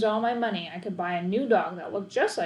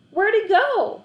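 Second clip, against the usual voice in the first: high, loud worried ask; boy frantic about the lost dog; panicked question; 12 semitones higher, 9 dB louder, slower than usual. A narrator's reading. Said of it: "I asked".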